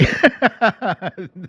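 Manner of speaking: high snorting giggle; a real snicker snort; snort laugh. Real, not acted. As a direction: snicker and snort through the nose. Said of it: "I snorted".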